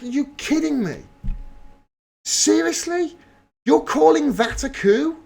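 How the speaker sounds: high, disbelieving outcry; can't believe what he's hearing; incredulous. Real, not acted. Reacting to protesters calling the speech a coup, incredulous.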